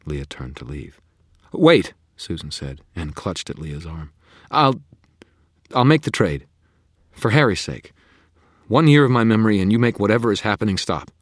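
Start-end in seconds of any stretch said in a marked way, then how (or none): 1.5-11.1 loud, high urgent plea; rushing, beg before she leaves; desperate Susan. A narrator's reading. Susan begs, clutching arm.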